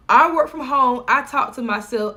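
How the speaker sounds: ordinary delivery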